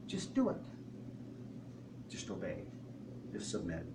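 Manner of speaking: God's quiet command. Retold or imitated. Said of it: "And God says".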